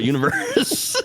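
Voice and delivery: laughing. Real, not acted. Laughing at own joke.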